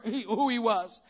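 normal talking voice

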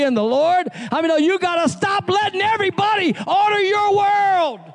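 high, fervent sermon climb; rising gospel preaching. Acted. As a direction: preach it hard, calling the church to answer. Preaching builds.

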